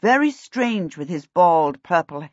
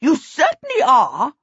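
high, loud, emphatic agreement; indignant king; 7 semitones higher, 5 dB louder than usual. A narrator's reading. King backing the warning after the chase, high and loud. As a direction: agree forcefully as an affronted king. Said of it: "panting and red in the face".

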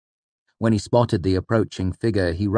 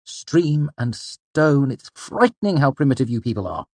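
disdainful exclamation; 7 semitones higher than usual. A narrator's reading. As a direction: marvel scornfully, stretching the oath. Disdainful exclamation at human primitiveness.

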